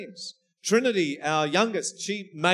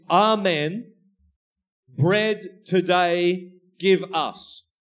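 slow deliberate reading; 4 dB louder, much slower than usual. Shown as a demonstration. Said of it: "if we put it from Syriac".

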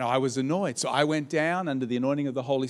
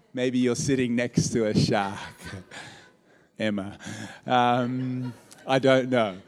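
ordinary talk

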